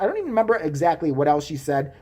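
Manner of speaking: ordinary talk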